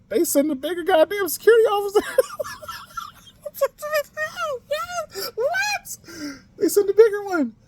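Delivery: high, loud disbelief; incredulous outburst. Real, not acted. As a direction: squeal it in disbelief. Outraged retelling of store.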